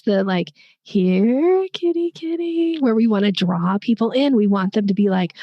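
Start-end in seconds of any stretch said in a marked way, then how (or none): 0.8-2.8 high, slow coaxing here kitty kitty; cat-calling voice. Shown as a demonstration. Coach showing how to call a cat, high coaxing voice. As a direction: gently coax a cat closer. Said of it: "cat calling energy".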